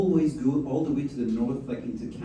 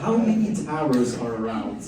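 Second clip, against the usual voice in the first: laughing; 4 dB louder than usual. Retold or imitated. Laughing retort at Parisian.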